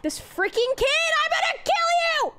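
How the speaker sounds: high, loud frustrated screaming; slow, shrieking threat to the game character; furious yell. Real, not acted. Raging at the climbing character.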